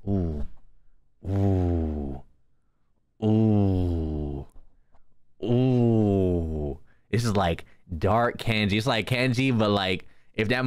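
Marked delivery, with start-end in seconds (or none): 0.1-6.8 drawn-out deep ooh, savoring the design; low admiring ooh. Pretend. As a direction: rumble out a slow impressed ooh.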